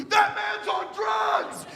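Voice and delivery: high, drawn-out mock scream; alarmed bystander. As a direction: squeal the accusation slowly as a horrified onlooker. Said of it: "you think people are gonna be like".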